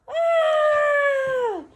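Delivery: high startled yelp; surprised high shout. Acted. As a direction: cry out in surprise. Shout as animals fly.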